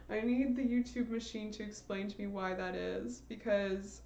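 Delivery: ordinary talk, nothing marked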